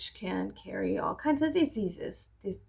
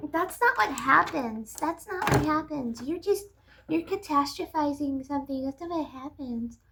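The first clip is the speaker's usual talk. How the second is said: mock dismissive people; 6 semitones higher than usual. Mocking commenters who dismiss her fears, high condescending voice. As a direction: parody the people telling her she's wrong.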